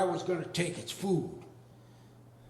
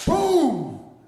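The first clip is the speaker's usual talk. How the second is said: much higher, louder, punchy boom; sudden crash; 9 semitones higher, 9 dB louder than usual. Retold or imitated. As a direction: slam the impact word out. Dog hit by car.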